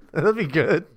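ordinary talk, nothing marked

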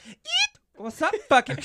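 high, loud squeaky yell; mock kid shout. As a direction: burst in like a cheeky kid. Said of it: "It was pretty much like".

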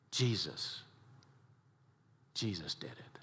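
almost whispered, low and tender; reverent hush. Acted. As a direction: hold it in awe. Reverent climax of sermon.

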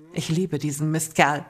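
ordinary delivery, nothing marked

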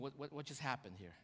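low, hushed, stammered disbelief; stunned. Retold or imitated. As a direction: think aloud softly, at a loss. Prophet stunned after the threat.

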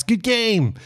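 high, excited imitated cheers; cheering teammates. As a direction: call out congratulations like teammates on the ice. Teammates congratulate him after goal.

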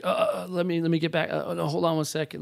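hesitant, scattered imitation of an unready doctor; mock flustered professional. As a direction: lightly act out being caught unprepared on a call. Mock unprofessional doctor taking a call.

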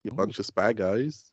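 slow, rising Australian accent; Australian accent attempt. Shown as a demonstration. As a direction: try an Australian accent, drawing it out. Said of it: "sounds really good in Australian accent".

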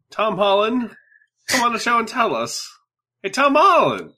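high-pitched call to an absent celebrity. Pretend. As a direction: hail a star from afar, pitched well up. Calling out to absent celebrity guest.

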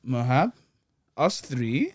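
simple words, each posed with a rising end; caveman talk. Pretend. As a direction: spell it out as if to a caveman. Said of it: "talk to him like a caveman".

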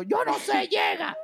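high sing-song mocking voice; mocking boss. Boss Moisés telling a sick worker to stay, high mocking sing-song. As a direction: chant it like a preachy boss, way up high. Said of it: "esa frase del".

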